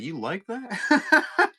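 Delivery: high, slow, stretched disbelief; incredulous. Retold or imitated. Dad asking his son about boxed mac, stunned and drawn out. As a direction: drag it out in shock.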